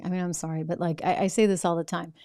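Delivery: normal talking voice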